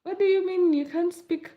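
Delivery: shrill jump up, then loud incredulous question; hammed-up disbelief, loud and high; mock disbelief. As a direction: pitch leaps high in fake shock. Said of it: "they'll be like".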